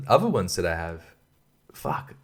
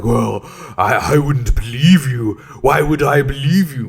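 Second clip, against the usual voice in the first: slow, louder put-on sceptical voice; mock distrustful buyer; 3 semitones higher, 4 dB louder than usual. Mimicking the buyer who refuses to believe him, sceptical. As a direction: sneer the buyer's doubt slowly.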